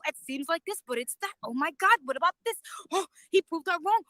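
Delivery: usual speaking voice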